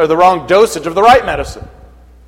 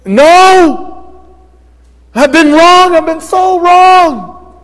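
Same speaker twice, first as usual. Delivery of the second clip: much higher, loud cry, last line slow; anguished cry; 11 semitones higher, 7 dB louder, much slower than usual. Acted. Saul realising his error, anguished shouting cry. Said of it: "His preeminent thought must have been".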